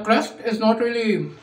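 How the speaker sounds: talking while biting pizza; muffled by food; mouth full. Real, not acted. Seen 0: slice raised to mouth, biting while speaking.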